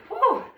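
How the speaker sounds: high whoop of effort; excited whoop. Real, not acted. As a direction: let out a sharp high whoo. Instructor cheering during hard squat.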